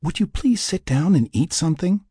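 high-pitched pleading; begging. A narrator's reading. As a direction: plead with a worried friend. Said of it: "Laura begged".

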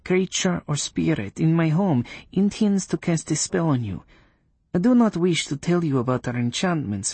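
high, stern witch voice. Acted. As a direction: speak as a proud coven witch.

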